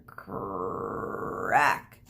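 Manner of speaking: low drawn-out crack sound; a groaning crack noise; creaky crack. Acted. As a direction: make the tree creak and split. Sound effect of cracking tree.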